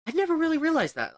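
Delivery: a sudden, high "oh wow" realization; surprised realization. Real, not acted. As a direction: jump up high and loud in surprise.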